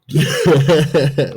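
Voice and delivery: high, loud burst of laughter; real laugh bursting out at your own jibe; amused laugh. Real, not acted. Speaker cracking up at own Tinkerbell fairies jibe, loud laugh.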